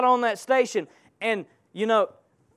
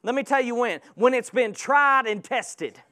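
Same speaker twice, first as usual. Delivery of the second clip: ordinary talk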